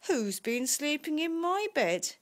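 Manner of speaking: story-time cross father bear; Daddy Bear. A narrator's reading. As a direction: call out the question crossly. Daddy Bear finds bed used.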